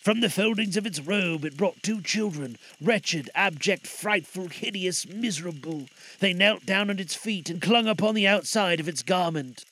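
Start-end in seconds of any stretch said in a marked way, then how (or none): none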